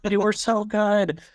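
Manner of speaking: high, slow, drawn-out plea; mock fan lament. Fans defending their niche band to others, mock lament. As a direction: stretch it out high like a wistful fan.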